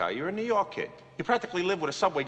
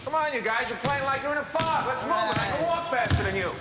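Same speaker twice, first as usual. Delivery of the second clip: hectoring coach; 8 semitones higher than usual. Acted. Coach hectoring sluggish players.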